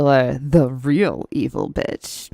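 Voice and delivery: low, slow villainous purr; menacing evil woman voice. Shown as a demonstration. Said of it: "I could even be an evil bitch".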